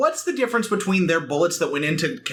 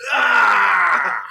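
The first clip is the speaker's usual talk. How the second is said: loud, hammed-up yell; long falling mock scream; David Hayter-style scream; 5 semitones higher, 7 dB louder than usual. Imagining another actor as Sonic in the crash, hammed-up scream. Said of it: "David Hayter".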